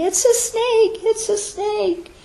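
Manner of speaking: high mock screams, slowing on the second; hammed-up terrified yelling; screaming in panic. Running out the door screaming about a snake, mock panic. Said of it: "you go screaming out the door".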